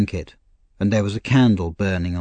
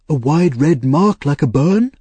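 cried out; 7 semitones higher, 6 dB louder than usual. Acted. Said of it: "cried Mister Brownlow".

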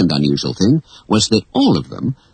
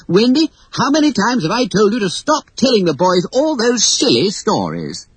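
scolding father; 12 semitones higher than usual. Acted.

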